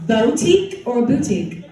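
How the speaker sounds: "boutique" first as "bow-tique", then as "boo-tique"; two pronunciations. Shown as a demonstration. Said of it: "Last one".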